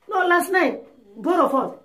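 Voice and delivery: insistent. Acted. Husband reminding forgetful wife, insistent.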